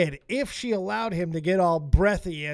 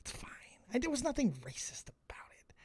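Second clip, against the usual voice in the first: whispered, softer, quick denial; whispering; 12 dB quieter than usual. Real, not acted. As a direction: whisper it to yourself.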